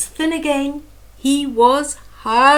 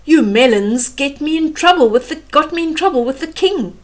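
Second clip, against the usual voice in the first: cross elephant; 5 semitones higher, 4 dB louder than usual. Acted. Elephant scolding melons.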